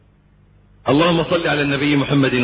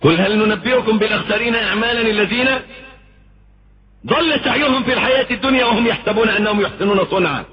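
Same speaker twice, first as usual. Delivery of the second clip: higher, intoned recital of the verse; Quran recitation; 6 semitones higher, faster than usual. Acted. Preacher recites verse to congregation, intoned recital.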